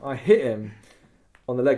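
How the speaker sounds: ordinary talk, nothing marked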